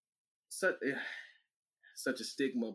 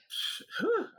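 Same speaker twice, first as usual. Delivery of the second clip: high laughing sigh; a breathy laugh-sigh. Real, not acted. Laugh at being wrong.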